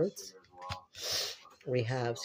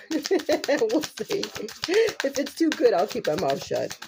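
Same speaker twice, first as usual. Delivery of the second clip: high teasing voice; 11 semitones higher, 3 dB louder, slower than usual. Acted. Teasing viewers about keeping secrets.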